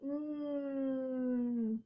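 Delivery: "Mm" hummed through the nose; n sound. Shown as a demonstration. Teacher modeling the n sound to kids. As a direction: hum the letter n. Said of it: "night owl".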